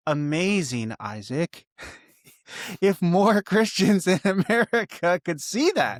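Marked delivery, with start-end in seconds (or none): 1.8-6.0 higher-pitched, laughing through the words; real laughter bubbling through speech. Real, not acted. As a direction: break into laughter mid-sentence. Laughs while making his wish.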